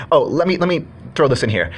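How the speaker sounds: ordinary talk, nothing marked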